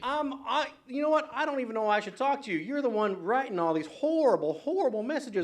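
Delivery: rising real irritation on air; riled up. Real, not acted. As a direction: push back at the caller, voice climbing in real annoyance. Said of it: "I'm just getting a little riled here".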